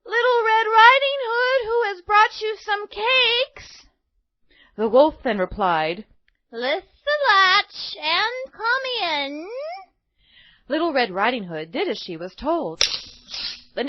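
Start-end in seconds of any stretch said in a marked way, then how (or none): 0.0-3.9 high, slow, loud call through the door. Acted. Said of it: "But she called out". 6.5-9.5 high, slow, put-on granny voice; wolf faking granny.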